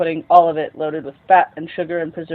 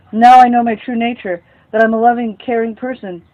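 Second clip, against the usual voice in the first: declaring; 4 semitones higher, 8 dB louder than usual. Acted. Son declares to mother.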